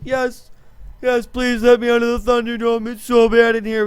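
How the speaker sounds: high, loud, pleading whine; a desperate prisoner pleading with the parole board; begging prisoner. Retold or imitated. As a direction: beg to be let out of the Thunderdome. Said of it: "he's gonna be like".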